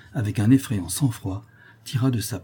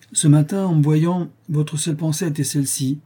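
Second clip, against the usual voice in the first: high, forceful reproach; stern accusation; 6 semitones higher than usual. Acted. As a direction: confront him with what you saw through. Father confronts his son.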